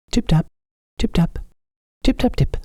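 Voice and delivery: high, light tapping sounds; little mice tapping on the dresser; tiny tapping. A narrator's reading. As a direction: tap it out high like tiny mouse feet. Chorus of tappings.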